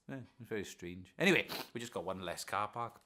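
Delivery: normal talking voice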